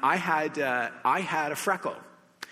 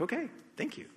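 puzzled thanks; 10 semitones higher, 7 dB quieter, faster than usual. Retold or imitated. Unsure reply after the surgery.

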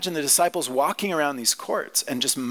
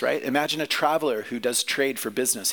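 ordinary talk

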